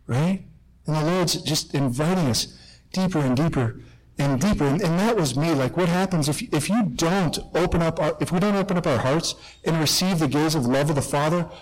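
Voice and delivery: usual speaking voice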